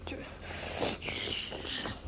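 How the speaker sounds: breathy half-voiced whisper. Real, not acted. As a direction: whisper it under your breath. Unvoiced whisper.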